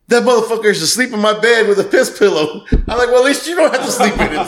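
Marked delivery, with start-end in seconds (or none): 0.1-2.6 high outraged buddy voice. Retold or imitated. 3.1-4.5 laughing. Retold or imitated. Joking reply laughing.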